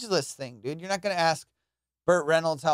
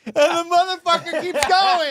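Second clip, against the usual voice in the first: exasperated outburst; 11 semitones higher, 7 dB louder than usual. Real, not acted. Exasperated punchline about barber.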